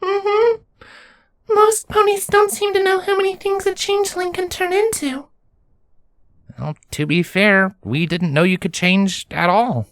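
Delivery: put-on very high, small childlike creature voice; small changeling nymph. A narrator's reading. Nymph answering Lenz in a high little voice.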